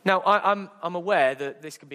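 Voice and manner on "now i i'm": normal talking voice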